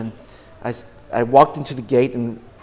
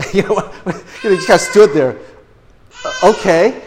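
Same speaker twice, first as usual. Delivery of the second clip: laughing through the words, high and loud; 6 semitones higher, 9 dB louder than usual. Real, not acted. Preacher tells church of being left speechless, laughing.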